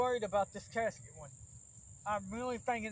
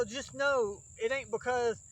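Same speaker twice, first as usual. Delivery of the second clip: ordinary talk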